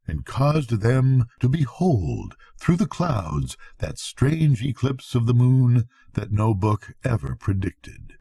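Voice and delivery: ordinary delivery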